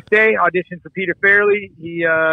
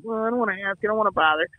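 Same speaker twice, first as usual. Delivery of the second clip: soft, hesitant mumbled excuse; mock-timid and small; 4 semitones higher, 5 dB quieter, faster than usual. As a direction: imitate a meek guy shying away. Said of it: "not one of these timid guys".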